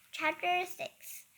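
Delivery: plain reading voice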